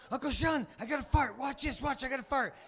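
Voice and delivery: put-on high, eager kid voice; a five-year-old bursting to show off; squeaky, excited child's voice; excited little boy.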